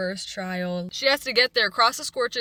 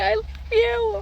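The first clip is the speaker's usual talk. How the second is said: excited; 12 semitones higher, 6 dB louder than usual. Retold or imitated. Gushing over the almost-confession.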